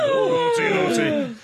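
high sing-song tutting; mock scolding. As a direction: scold like a teasing nanny. Host scolds rule breaker.

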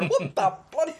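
high startled yelp through laughter; mock startled. Startled by someone in the room, mock shocked. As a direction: act out a fright, pitched right up. Acting out jumping in fright.

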